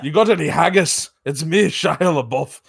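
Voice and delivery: joking, hammed-up Scottish accent. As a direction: put on a comic Scottish brogue. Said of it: "a fucking Scottish accent".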